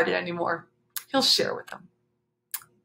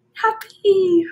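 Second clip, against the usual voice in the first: happy sung out much higher and louder; happy pitched well higher, louder; 10 semitones higher, 6 dB louder than usual. A narrator's reading. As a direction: lift happy up high and bright. Reads happy with emphasis.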